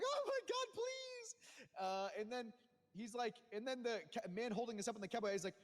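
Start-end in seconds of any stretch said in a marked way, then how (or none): 0.0-1.4 much higher, squeaky mock plea; mock pleading. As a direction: beg like a terrified hostage, way up high. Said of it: "And I'm like".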